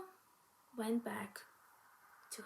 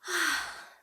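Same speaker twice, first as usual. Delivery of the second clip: weary sigh; 4 semitones higher, 4 dB louder than usual. Acted. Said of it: "said the girl".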